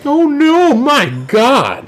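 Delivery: high, loud hammed-up dismay; mock horror. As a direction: cry out in exaggerated horror. Mock horror at a crude joke.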